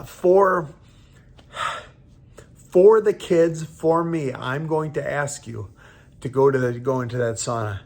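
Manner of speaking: real tearful voice, halting with long pauses; choked up. Real, not acted. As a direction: push through the lump in your throat. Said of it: "I'm tearing up".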